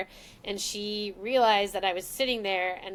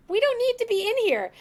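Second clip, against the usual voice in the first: much higher, louder insisting; insistent; 12 semitones higher, 5 dB louder than usual. Retold or imitated. Daughter insisting to her mother they go to the bedroom. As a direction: urge her toward the bedroom. Telling mom not the office.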